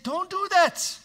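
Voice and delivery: sudden high, loud shout; Jesus shouting a stern no; forceful prohibition. Acted. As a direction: forbid it outright with a cry. Jesus forbidding careless laying on.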